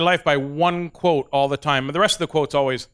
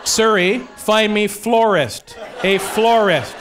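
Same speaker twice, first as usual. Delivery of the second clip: loud, slow commands to the phone through laughter; calling out to phone; 4 semitones higher, 3 dB louder, much slower than usual. Retold or imitated. Louder, laughing, as he orders his phone to find a florist. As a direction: talk at the phone like it's deaf.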